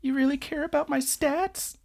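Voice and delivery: high put-on voice for an earnest question; Amano. Boy asking his friend about his stats, mock high-pitched. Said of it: "where Amano's like".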